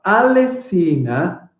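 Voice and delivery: very slow "Alessina Eskandari", pausing between the names; deliberate, stretched syllables. Shown as a demonstration. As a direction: count out "Alessina Eskandari" beat by beat. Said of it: "I think I got eight".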